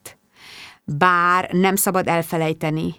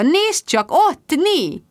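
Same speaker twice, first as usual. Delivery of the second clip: excited pointing; 10 semitones higher, much slower than usual. A narrator's reading.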